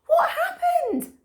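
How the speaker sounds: very high, slow, loud question; high slow Karen. A narrator's reading. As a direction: sound alarmed and curious. Karen asks.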